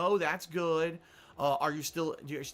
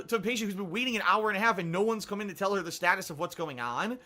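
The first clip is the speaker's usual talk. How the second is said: ordinary delivery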